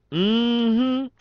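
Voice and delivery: very high imitated knowing hum. Shown as a demonstration. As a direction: demonstrate her signature "Mm-hmm". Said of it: "if you hear that and it sounds like that".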